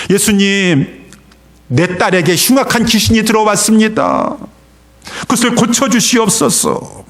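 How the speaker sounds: high, pleading call; crying out. Acted. Canaanite mother begging Jesus for her daughter, crying out. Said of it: "더 소리 질렀습니다".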